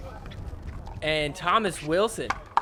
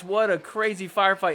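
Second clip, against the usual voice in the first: ordinary delivery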